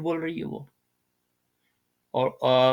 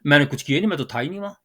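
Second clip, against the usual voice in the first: mocking whiny excuse; much faster than usual. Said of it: "اس طرح تھوڑی بات کرتے ہیں".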